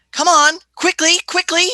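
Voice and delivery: hurrying Vicky along; hurrying urgently. Acted. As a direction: urge her to move quickly. Doctor hurries girl along.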